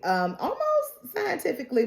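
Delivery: ordinary talk, nothing marked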